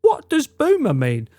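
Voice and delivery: high, puzzled mum voice. Retold or imitated. Mother reading chat aloud to son, high puzzled voice. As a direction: play his mother innocently asking. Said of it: "she's gonna go".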